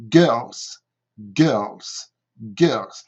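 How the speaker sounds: "girls" ending in a voiced Z. Shown as a demonstration. Teacher models plural "girls" with a Z ending. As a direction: stress the final Z of "girls". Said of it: "le S se prononce comme un Z".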